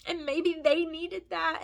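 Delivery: normal talking voice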